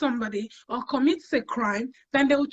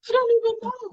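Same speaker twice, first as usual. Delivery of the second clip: upset and lost; 9 semitones higher, 4 dB louder than usual. Retold or imitated. Retold crying at the GP.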